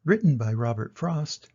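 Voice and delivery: ordinary delivery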